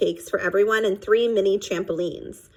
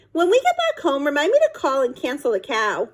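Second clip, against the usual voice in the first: put-on high, playful child voice; 6 semitones higher than usual. A narrator's reading. Child's joke from back seat.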